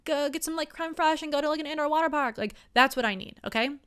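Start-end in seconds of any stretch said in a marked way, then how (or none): 0.1-2.4 higher, breathless trend-chasing gush; gushing friend. Retold or imitated. Friends hyped about a TikTok trend, mock gushing. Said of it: "my friends are like".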